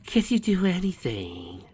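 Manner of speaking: high, slow, drawn-out plea; begging. A narrator's reading. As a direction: plead desperately, stretching the words. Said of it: "Billy begged".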